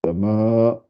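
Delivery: normal talking voice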